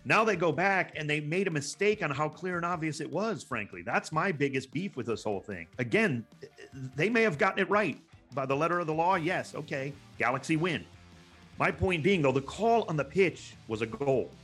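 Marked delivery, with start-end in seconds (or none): none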